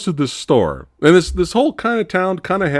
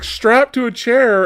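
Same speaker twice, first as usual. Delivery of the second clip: normal talking voice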